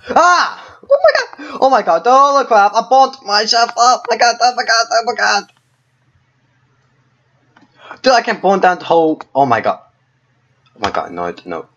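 panicked high yelling; scared horror game streamer. Real, not acted. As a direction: freak out as you burn. Burning himself in the game.

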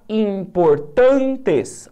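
Importantes drawn out very slowly, syllable by syllable, leaning on tan; slow syllable stress. Shown as a demonstration. Teacher sounding out a word for students, very slow and drawn out. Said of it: "A sílaba tônica tá aqui".

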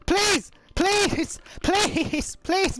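high frantic screams of no and please; panicked screaming. Real, not acted. Gamer screaming at the game as the character falls. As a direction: scream and beg as it all goes wrong.

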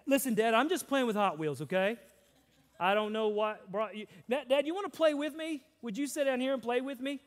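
put-on high, loud, eager kid voice; a little boy pulling dad down to play; son inviting dad to play. Son with Hot Wheels asks dad to play, eager kid. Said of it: "as if to say".

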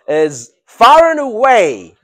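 FAR AND AWAY loud, high and slow; emphatic stress. Shown as a demonstration. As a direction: stretch out "far and away" with force. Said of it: "more emphasis".

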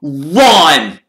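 sudden high, booming call; loud shout. A narrator's reading. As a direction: startle them with one big shout. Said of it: "a loud voice made all three".

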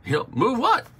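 high, puzzled question as God; mock-bewildered God asking move what. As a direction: play God baffled by the plea. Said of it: "God is saying".

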